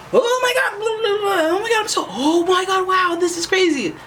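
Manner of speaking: very high, hammed-up squealing babble; mock overexcited gushing. Teasing Aquarius about who they aren't, mock overexcited gushing. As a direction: gush wildly like someone easily overstimulated, exaggerated. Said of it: "You're not the type to be like".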